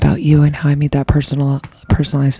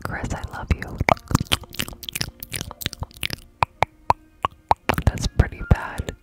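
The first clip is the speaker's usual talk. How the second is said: ear cleaning asmr; 4 dB quieter than usual. Real, not acted.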